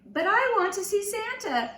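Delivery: put-on a little boy begging to see Santa; whining. A narrator's reading. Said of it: "Patrick said with a whine".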